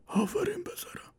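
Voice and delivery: put-on high old man whispering; old father whispers praise to son; whispered praise. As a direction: breathe it out like a frail father.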